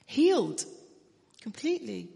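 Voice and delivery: praising a miraculous recovery with a ringing cry; joyful burst. Real, not acted. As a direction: lift the word up in real triumph. Climax of the healing story.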